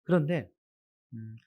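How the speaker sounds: ordinary delivery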